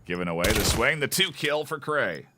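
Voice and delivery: fast, rising play-by-play call; esports caster. Real, not acted. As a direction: call the action as it happens. Live play-by-play of a match.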